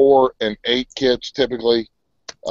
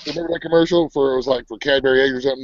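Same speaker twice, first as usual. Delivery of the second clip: normal talking voice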